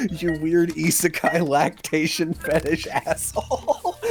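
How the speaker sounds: high, laughing mock indignation; mock-outraged question through laughter; laughing outrage. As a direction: scold him while cracking up.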